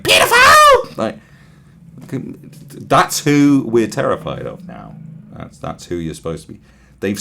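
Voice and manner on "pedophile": far higher, much louder screech; shrill, full-throated yell; mock protest yell. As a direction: scream it like an unhinged protester. Protester shouting at Clinton picture.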